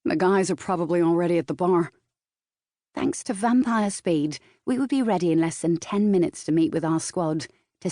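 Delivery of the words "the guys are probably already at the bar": quick, lightly voiced Californian boyfriend; brisk reminder with a hint of Californian accent. A narrator's reading. Boyfriend telling partner squad is waiting, light Californian accent. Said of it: "his Californian accent".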